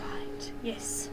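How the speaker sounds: soft unvoiced whisper. Real, not acted. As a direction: breathe the words only. Whispering in game scene.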